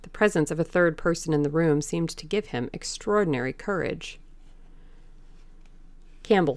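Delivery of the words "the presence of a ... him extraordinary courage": ordinary delivery, nothing marked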